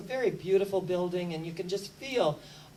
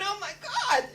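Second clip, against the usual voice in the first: mock horror; 12 semitones higher than usual. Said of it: "And he's going".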